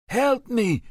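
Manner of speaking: shrill, very high, loud cry for help. A narrator's reading. Stabbed prisoner crying for help, very high and loud. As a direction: scream for help in pain. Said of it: "Ahmed cried".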